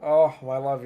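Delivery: ordinary talk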